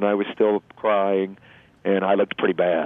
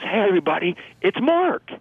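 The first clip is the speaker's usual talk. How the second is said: much higher, mock rallying cry; retold crowd yell. Pacer rallying marathon crowd for struggling runner, high mock yell. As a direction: imitate a runner hyping up the crowd, pitched way up and slowing at the end. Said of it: "did the exact same thing".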